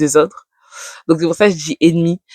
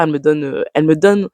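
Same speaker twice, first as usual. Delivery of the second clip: ordinary talk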